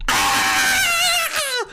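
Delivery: high excited yell; excited scream. Real, not acted. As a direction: let out a hype shriek. Hype shout on stream.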